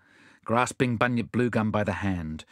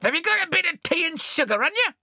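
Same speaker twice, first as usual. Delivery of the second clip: very high squawky parrot; high, cheeky parrot character; faster than usual. A narrator's reading. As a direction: pester in a high-pitched bird voice. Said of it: "said the parrot".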